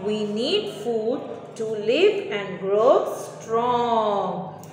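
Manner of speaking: slow, clear model answer; slow dictation. Shown as a demonstration. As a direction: spell out the answer for pupils. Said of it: "So the answer is".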